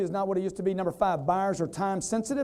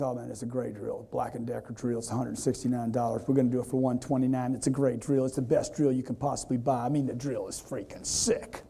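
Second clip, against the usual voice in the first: a lowered, softer imitation of the hardware store salesman; lightly voiced salesman talking up his drill, deeper and hushed; pushy salesman; 7 semitones lower, 3 dB quieter than usual. Salesman pitching a drill to the customer, imitated low and soft. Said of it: "guy says".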